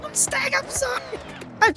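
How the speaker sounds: panicked. Real, not acted. Streamer spinning out behind the Mustang, panicked. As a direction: cry out in a real panic.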